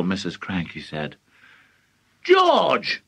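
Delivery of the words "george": high, urgent call; shout. A narrator's reading. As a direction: call the boy sharply. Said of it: "Mister Crankie shouted".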